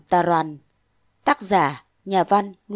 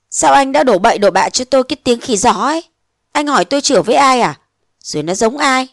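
put-on indignant young woman; 2 semitones higher, 3 dB louder than usual. Nguyệt answering Phong's suspicion, indignant outburst. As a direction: fire back, wounded pride.

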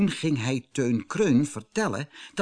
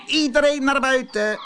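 high, loud rooster command; loud crowing; 7 dB louder than usual. Acted. Herman Haan crows the hens outside, loud and high. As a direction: crow everyone outside. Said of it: "kraaide Herman Haan".

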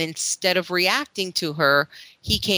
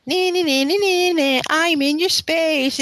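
teasing singsong; 13 semitones higher, 3 dB louder than usual. Retold or imitated. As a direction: needle him like a playful bully. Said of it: "and she was like".

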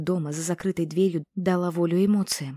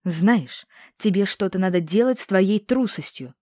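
louder, scolding; angry; 3 semitones higher, 6 dB louder than usual. A narrator's reading. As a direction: lose patience with her cowardice. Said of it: "разозлилась ведьма".

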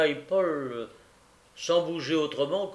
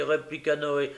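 plain reading voice

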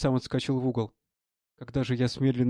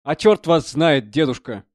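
drawn-out, louder careless retort; careless reply; 2 semitones higher, 8 dB louder, slower than usual. A narrator's reading. Artist answers the old prisoner's question about his portrait, carelessly. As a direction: toss it off slowly, as a young artist would. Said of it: "небрежно ответил".

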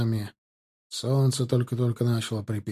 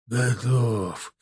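breathed out; 3 semitones lower than usual. A narrator's reading. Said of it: "выдохнул Чанов".

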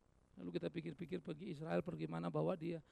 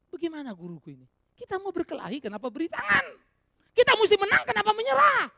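building from puzzled to a much higher, louder protest; shocked, frustrated Peter; 14 semitones higher, 17 dB louder than usual. Acted. Peter protesting to his surrendering teacher, building to a loud outcry. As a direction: rise into an outraged plea to fight. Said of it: "Bagaimana Petrus nggak shock?".

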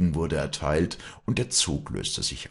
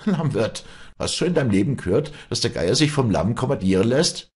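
high, louder mocking question; derisive taunt; 8 semitones higher, 6 dB louder than usual. A narrator's reading. Farm woman laughing off innkeeper's order, derisive. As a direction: toss back a pun in ridicule.

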